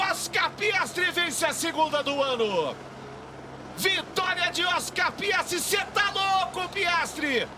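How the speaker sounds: high, slow victory call; excited shout. Real, not acted. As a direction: shout the winner home. Winner crosses the line. (grito de vitória empolgado)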